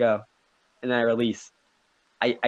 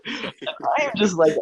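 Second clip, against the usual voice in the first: usual speaking voice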